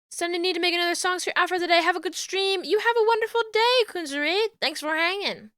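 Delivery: bright, high, fast goodbye; cheerful sign-off. Real, not acted. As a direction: chirp thanks to the chat. Thanking viewers, stream sign-off.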